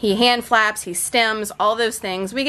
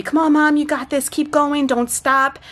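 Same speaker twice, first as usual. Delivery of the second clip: higher, lightly voiced little boy cheering Mom on; imagined son cheering; 6 semitones higher than usual. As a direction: cheer her on like a small son. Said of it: "telling me".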